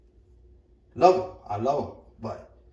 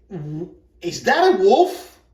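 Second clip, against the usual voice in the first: higher, louder, slow startled question; retold shock; 6 semitones higher, 9 dB louder, slower than usual. Dad seeing son's wolf dog, shocked. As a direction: gasp it out slowly in disbelief.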